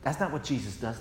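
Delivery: ordinary delivery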